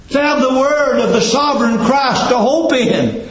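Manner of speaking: high, ringing preacher's cry; soaring sermon voice; preaching at full force. Acted. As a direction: lift it up and hold it like a sermon climax. Preaching climax, voice soars.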